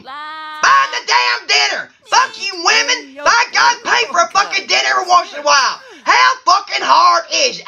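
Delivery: hammed-up angry shouting, pitch climbing; ranting bachelor. Bachelor three complaining about paying for dinner, angry rant. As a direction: rant at full tilt in indignation.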